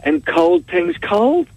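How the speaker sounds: high, slow explaining; patient explaining shopkeeper. Acted. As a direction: spell it out slowly to a simpleton. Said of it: "And the guy says".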